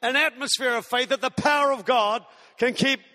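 rising, insistent preacher's cadence; stirring sermon crescendo; preaching build. Acted. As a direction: build each eventually toward a climax.

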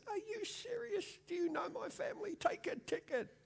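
high-pitched mock indignation, laughing; mock outrage. Preacher retelling his retort to a woman, high mock outrage. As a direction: squeal it up, incredulous and amused. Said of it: "I said".

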